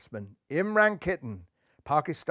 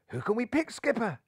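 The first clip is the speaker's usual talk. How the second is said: high, eager question; a touch of urgent excitement; excited exclamation; 7 semitones higher than usual. A narrator's reading. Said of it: "exclaimed the bunnies".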